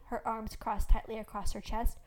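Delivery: ordinary delivery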